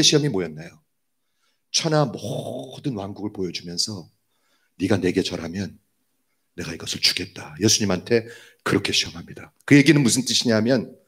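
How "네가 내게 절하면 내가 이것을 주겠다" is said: low, softened devil's offer; tempting offer. Retold or imitated. The devil offering Jesus the kingdoms, low tempting voice. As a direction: tempt quietly, voice dropped low.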